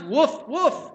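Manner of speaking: two high, loud yipping woofs; hammed-up doggy woof woof; double dog woof. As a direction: bark twice like an eager dog. Dog answers butcher in joke.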